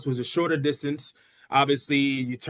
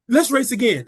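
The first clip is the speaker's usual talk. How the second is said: higher, louder burst; insistent rematch demand; 9 semitones higher, 7 dB louder than usual. Retold or imitated. Beaten kid to sister after the race, insistent. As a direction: act out a sore loser demanding another go.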